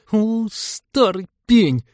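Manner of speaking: put-on slow, high, drawn-out girlish insult; high, loud, cross young woman; angry hiss. A narrator's reading. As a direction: seethe at a stubborn old man, stretching each word. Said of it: "сердито прошипела девушка".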